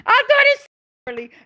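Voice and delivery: high, loud and fast exclamation; surprised outburst. Real, not acted. Reader realizing she misread a viewer name, surprised outburst.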